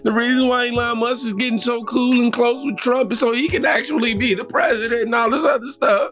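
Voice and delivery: high whiny mock of a liberal, dramatic. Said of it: "the left's new rhetoric".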